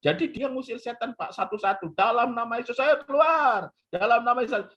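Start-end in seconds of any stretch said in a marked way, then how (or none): 1.9-4.7 high, insistent mock command; mock exorcism. Friend expels demons one by one, high mock exorcism. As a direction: cast out demons with showy force.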